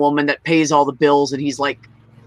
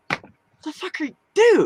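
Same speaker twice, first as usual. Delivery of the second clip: high, incredulous "what are you even doing"; replaying her outrage at the lazy brother, pitched way up; exasperated disbelief; 11 semitones higher, slower than usual. To the brother who won't work, high and incredulous. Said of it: "And I'm like".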